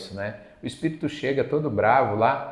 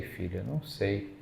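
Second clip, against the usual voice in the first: soft slow reply; 2 semitones lower, 8 dB quieter than usual. Retold or imitated. Chico answering the woman gently.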